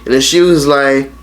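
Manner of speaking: normal talking voice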